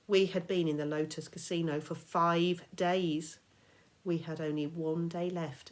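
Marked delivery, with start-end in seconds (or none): none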